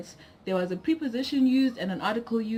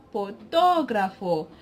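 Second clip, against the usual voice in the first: "fotógrafo" with the stress on "tó"; stress on second syllable; slower than usual. Shown as a demonstration. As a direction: lean on the "tó" in "fotógrafo". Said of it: "we place stress on the".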